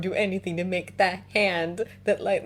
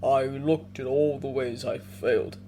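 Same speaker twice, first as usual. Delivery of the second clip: put-on low and grave like an old bear; deep bear voice; 4 semitones lower than usual. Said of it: "Yorick's deep voice".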